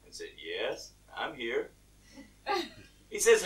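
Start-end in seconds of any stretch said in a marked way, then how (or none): 0.4-1.7 low, soft, unhurried answer; voice from above. Acted. God replying to the hanging man, low and quiet. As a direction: answer calmly in a deep hush. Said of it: "a voice came back".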